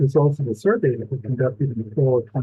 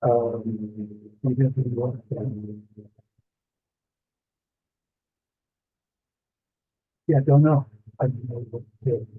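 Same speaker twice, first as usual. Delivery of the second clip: mumbled underwater audio; 2 semitones lower, 3 dB quieter than usual. Real, not acted. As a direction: talk like you're under water. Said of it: "you sound like you're underwater and it sounds very mumbled".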